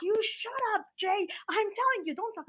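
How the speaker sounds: high, insistent imitation of a boss; mock scolding. Boss silencing Jay, retold.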